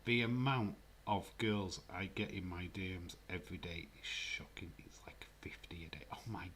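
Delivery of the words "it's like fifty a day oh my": mock-bragging whisper; hushed showing-off. Real, not acted. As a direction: whisper it like a secret boast.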